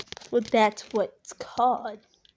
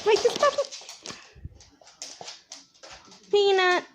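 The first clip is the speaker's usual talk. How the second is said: talking to a dog; 7 semitones higher than usual. Real, not acted. Calling the dog.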